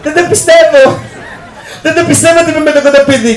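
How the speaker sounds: high, giggly disbelief; a hint of a swooning girl; starstruck fan girl. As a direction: gush like an excited fan. Girl's starstruck voice acted.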